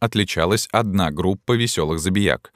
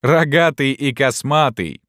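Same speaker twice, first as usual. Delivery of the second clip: jeering call; 7 semitones higher, slower than usual. A narrator's reading. Someone adds to the jeering.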